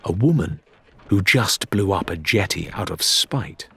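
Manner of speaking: plain reading voice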